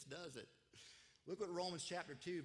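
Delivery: normal talking voice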